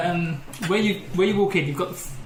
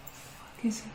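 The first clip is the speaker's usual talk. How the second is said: whispered puzzled curse; a hushed mock of a searching flatmate; 4 semitones higher, 13 dB quieter, slower than usual.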